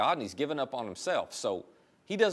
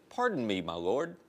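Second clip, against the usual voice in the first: polite. Retold or imitated. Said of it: "It's so, so nice".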